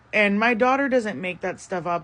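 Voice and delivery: normal talking voice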